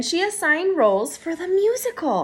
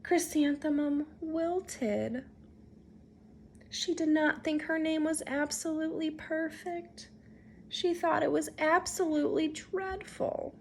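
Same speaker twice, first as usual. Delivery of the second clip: much softer, deflated narration; quiet and crestfallen; wilting; 10 dB quieter than usual. A narrator's reading. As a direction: let the voice sink with her. Chrysanthemum sinks at teasing.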